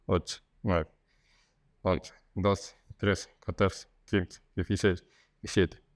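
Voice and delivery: slow, steady counting to hold a stretch; exercise count. Shown as a demonstration. Physio counting seconds for viewers doing the exercise, slow and steady.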